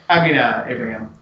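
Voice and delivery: ordinary delivery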